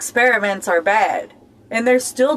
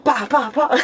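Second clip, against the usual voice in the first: mock slapping noises; 3 semitones higher than usual. Mock slapping people, laughing.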